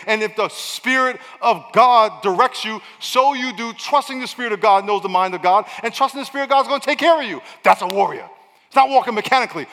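loud, rising preacher's cry; preaching. Acted. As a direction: preach it, lifting the congregation. Preacher building to a shout.

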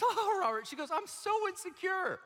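high, put-on voice of a cheerful girl; mock girl voice. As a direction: play the joyful girl lightly.

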